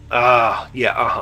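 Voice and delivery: ordinary delivery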